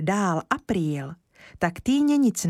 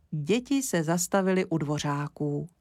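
plain reading voice

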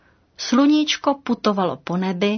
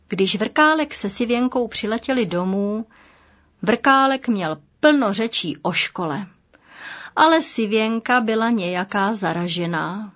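usual speaking voice

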